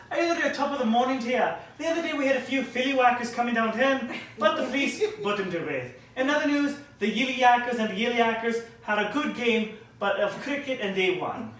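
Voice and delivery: fast, high mock Irish newsreader; mock Irish accent. Comic parodies Irish news in a mock Irish accent. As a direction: rattle it off like a jolly Irish news anchor. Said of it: "the news is".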